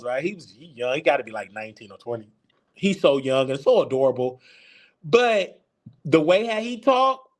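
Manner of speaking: ordinary talk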